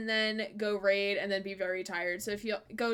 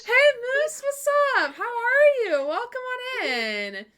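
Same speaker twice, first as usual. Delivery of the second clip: higher, louder, beaming welcome; excited greeting; 12 semitones higher, 8 dB louder than usual. Real, not acted. Greeting a new viewer.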